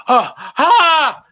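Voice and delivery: high, loud gasping shriek; startled scream. Retold or imitated. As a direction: scream at the sudden sight of a monster. Nancy sees the Yeti.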